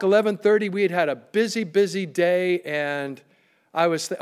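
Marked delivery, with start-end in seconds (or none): none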